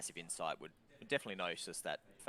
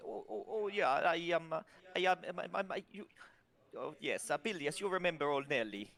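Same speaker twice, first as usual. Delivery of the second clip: higher, stammering and fumbling for words; flustered young voice; 9 semitones higher than usual. Acted. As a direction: caught out, buying time. Flustered character stalling for answer.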